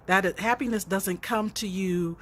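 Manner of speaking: ordinary delivery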